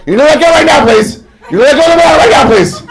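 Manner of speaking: loud, high, strained demand; increasingly perturbed yell. Retold or imitated. Guide losing patience with the model clutching a banana, loud high shout. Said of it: "getting more and more perturbed".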